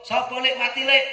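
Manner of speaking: high-pitched, urgent village question; calling a neighbour. Acted. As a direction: call out to find who died. Another neighbour asks who died. (niru tangga takon, swarane dhuwur)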